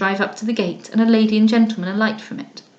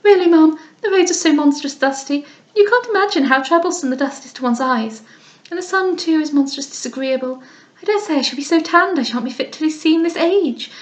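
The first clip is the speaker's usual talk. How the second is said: put-on affected young lady; 6 semitones higher than usual. Lady Louisa complains to Mrs Beaumont about the dust, affectedly high. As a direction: whine about the dust like a pampered girl. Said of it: "protesting in a most affected voice".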